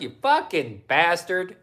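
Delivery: ordinary talk, nothing marked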